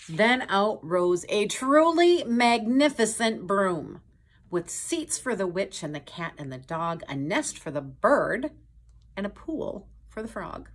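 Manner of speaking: put-on storyteller amazing the kids; grand narration. A narrator's reading. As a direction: unveil the broom with wonder. Narration broom reveal.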